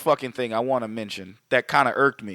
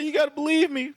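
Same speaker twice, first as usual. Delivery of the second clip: pleading high voice; 12 semitones higher than usual. Retold or imitated. Pleading guy in movie retold.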